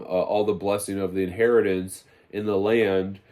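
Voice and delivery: ordinary talk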